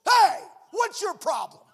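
gruff confrontational retort; mock angry snap. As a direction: bark it at the offender. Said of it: "Loving and kind, compassionate pastor".

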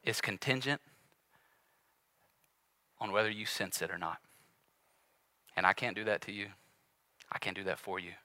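low, soft, earnest preaching; hushed appeal. Real, not acted. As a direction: drop right down to a quiet plea.

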